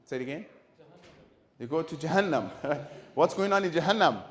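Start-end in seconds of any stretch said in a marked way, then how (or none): 3.1-4.2 mock scary threat. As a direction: ham up the hellfire warning like a scare story. Mock hellfire threat to kids.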